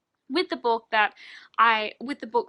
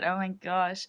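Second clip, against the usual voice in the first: ordinary delivery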